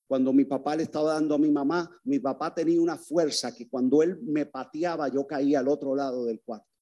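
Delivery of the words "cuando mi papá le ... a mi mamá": ordinary talk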